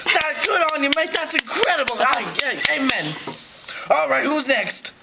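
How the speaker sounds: high, cheery Australian; Australian shark host. Pretend. Shark leading the meeting, cheery Australian voice. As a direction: cheer them on like a hearty Aussie host.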